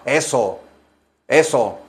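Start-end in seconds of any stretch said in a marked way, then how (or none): none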